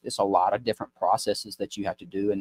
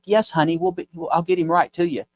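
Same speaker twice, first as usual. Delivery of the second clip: sweet clerk; 8 semitones higher than usual. Retold or imitated. Pharmacy clerk voiced in story.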